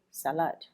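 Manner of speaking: "salad" stressed on the second syllable, suh-LAD; weak first syllable in "salad", weight on the last; wrong-syllable stress. Shown as a demonstration. Teacher showing learners the mistake, second-syllable stress on "salad". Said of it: "you're stressing the wrong syllable".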